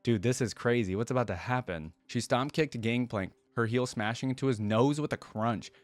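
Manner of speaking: ordinary delivery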